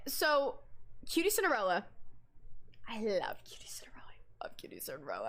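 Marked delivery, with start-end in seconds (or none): 2.9-4.0 fast breathy whisper of love for her; soft whispered fangirl line; whispered gush. Retold or imitated. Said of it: "me saying I love Cutie". 4.4-5.3 much lower, softer whispered repeat; dreamy hushed whisper, nearly gone. Retold or imitated. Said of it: "me saying I love Cutie".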